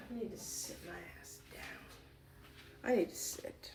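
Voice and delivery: slow tired whisper. Real, not acted. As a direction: breathe it out, worn out. Exhausted, needs to sit.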